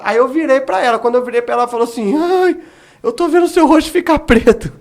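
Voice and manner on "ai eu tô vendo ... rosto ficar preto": higher, louder frightened cry; retold fright. Man voicing his fiancée's frightened cry, higher and louder. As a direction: voice her alarm as she sees his face.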